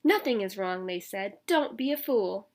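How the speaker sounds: lively storybook read-aloud; reading to children. A narrator's reading. Teacher reading a picture book to children, lively. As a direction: read it out to the kids with bounce.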